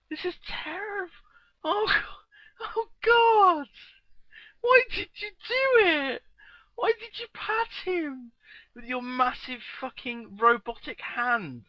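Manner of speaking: high, loud wailing lament; mock anguish. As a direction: wail it like a grieving friend. GM mock anguish over goblin.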